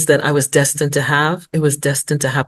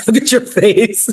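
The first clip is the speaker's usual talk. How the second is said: laughing through the words, pitched up; breaking into giggles at a joke; 5 semitones higher than usual. Real, not acted. Laughing at host's face.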